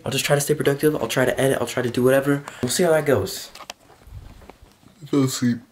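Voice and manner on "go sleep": ordinary talk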